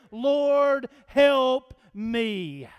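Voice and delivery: loud, drawn-out prayer for help; emphatic plea. Retold or imitated. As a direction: plead it out firmly, word by word. Acting out the woman's prayer.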